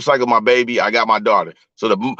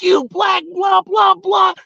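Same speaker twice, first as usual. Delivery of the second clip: high shrieking imitation of the ex-wife; hammed-up furious ex-wife; mock ex-wife yelling; much slower than usual. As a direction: screech it up high like she lost it in the hall. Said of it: "She's like".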